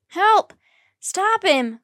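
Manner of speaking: shouted cry for help, high and loud. A narrator's reading. Jack yelling after the boy who stole his bag. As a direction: yell for help as the bag is snatched. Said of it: "cried Jack".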